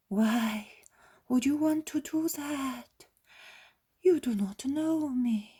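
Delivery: put-on high, frail sick old woman's voice; a dying old woman, wary and weak; feverish old woman. A narrator's reading. Bedridden old woman doubting the healer at her bedside.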